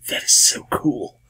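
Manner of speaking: breathy, drawn-out whisper; awed whisper. Real, not acted. Host to any Irish viewers, awed whisper. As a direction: whisper it slowly, like a delighted secret.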